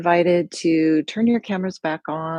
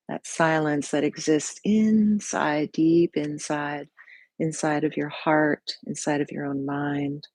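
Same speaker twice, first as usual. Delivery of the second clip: guided meditation. Real, not acted. Leads a body scan.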